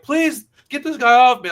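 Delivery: high, loud mock plea; hammed-up cry for help; mock pleading. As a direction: beg for rescue in a put-on panicked voice. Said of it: "This guy would be like".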